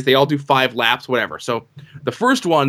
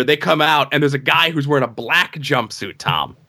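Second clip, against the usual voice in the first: ordinary delivery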